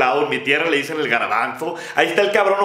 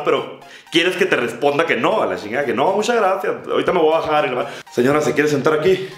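normal talking voice

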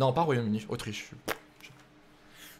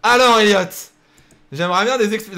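mock stern callout; 7 semitones higher, 11 dB louder than usual. Calls out a viewer for explanations.

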